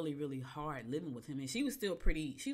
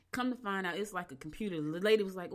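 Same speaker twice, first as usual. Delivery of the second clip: ordinary talk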